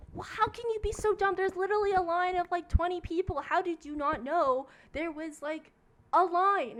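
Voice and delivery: loud, high exasperated scolding; retold angry outburst. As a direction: imitate an irate woman berating a line-cutter. Pregnant lady berates line-cutter, retold.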